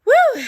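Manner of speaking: loud, high, exhausted woo; a huge breathless whoop; whooping exhale. Real, not acted. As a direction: blow out a big relieved woo. Exhales after chasing dog.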